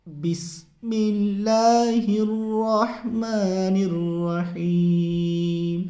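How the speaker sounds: slow chant, الرحمن full-mouthed and الرحیم light; tajweed recitation. Shown as a demonstration. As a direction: hold the vowels, heavy on الرحمن, thin on الرحیم. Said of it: "رحمان کو منہ بھر کے".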